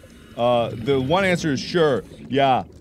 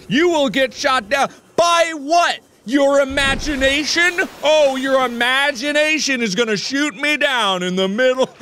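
loud, high sarcastic taunting; mocking shout; 11 semitones higher, 4 dB louder than usual. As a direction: yell it back at them scornfully. Mocking the audience's cannon theory.

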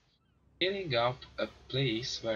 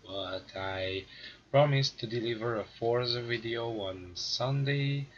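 ordinary delivery

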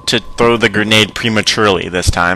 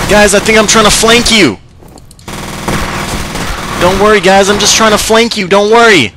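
calling out; 8 semitones higher than usual. Real, not acted. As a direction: shout it across to teammates. Calling out to teammates.

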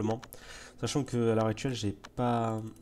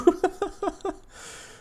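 very high squealing laugh; very high squeal of laughter. Real, not acted. Laughs at own bad luck.

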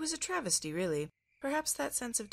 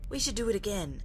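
put-on higher, louder eager child; excited; 5 semitones higher, 6 dB louder than usual. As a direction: bubble over with excitement. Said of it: "excited eyes".

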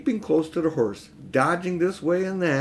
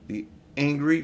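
ordinary delivery

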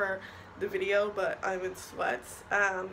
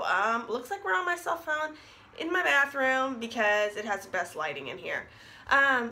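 speaking around metal brackets; talking with braces; 3 semitones higher, 3 dB louder than usual. Real, not acted. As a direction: lips over orthodontic brackets. Seen 0: Metal brackets visible on teeth whenever she speaks; elastics not clearly seen.

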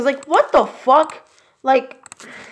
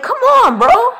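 exasperated yell; 12 semitones higher, 5 dB louder, slower than usual. Real, not acted. Exasperated yelling at quarterback.

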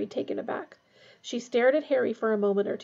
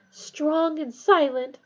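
slow, higher-pitched swooning; dreamy admiration; 5 semitones higher, 4 dB louder, much slower than usual. A narrator's reading. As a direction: draw it out like a smitten girl. Girls giggling over a handsome boy.

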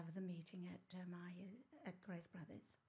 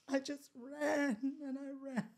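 tearful, slow, higher; crying; 5 semitones higher, 7 dB louder than usual. Real, not acted. Recalling running down the street, crying. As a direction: let the tears break through.

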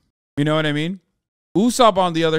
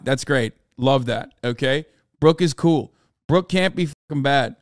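usual speaking voice

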